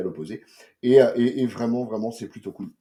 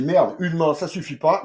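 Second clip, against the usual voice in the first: louder, higher hammed-up outburst; mock fed-up publisher; 6 semitones higher, 8 dB louder than usual. Publisher going all in, a mock loud outburst. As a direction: play the publisher slamming in, half-joking. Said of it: "il a dit".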